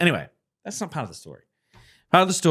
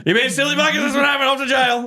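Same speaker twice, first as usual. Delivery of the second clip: mock scolding; 14 semitones higher, 6 dB louder, faster than usual.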